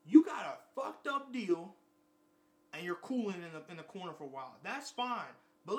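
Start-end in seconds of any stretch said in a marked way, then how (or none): 0.1-1.7 loud, high, slow put-down; loud slow scold. Real, not acted. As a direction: hammer it out slowly and loud. Scolding non-daters.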